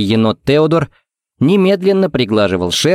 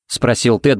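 plain reading voice

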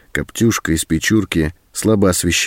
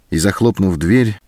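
usual speaking voice

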